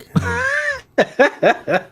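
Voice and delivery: very high squealing laugh; real high-pitched cackle. Real, not acted. Own burst of laughter.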